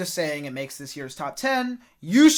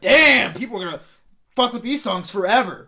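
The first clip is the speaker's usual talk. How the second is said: excited; 2 semitones higher, 7 dB louder than usual. Retold or imitated. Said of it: "but then it's like".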